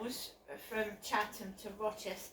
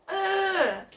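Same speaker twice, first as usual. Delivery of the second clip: loud exasperated ugh; frustrated groan; 7 semitones higher, 8 dB louder than usual. Real, not acted. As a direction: let out a fed-up groan. Frustrated outburst.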